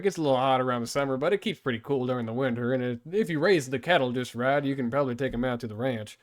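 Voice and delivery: chatty hammed-up Texas accent; Southern accent. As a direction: play the folksy Texan for foreigners. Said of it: "from Texas".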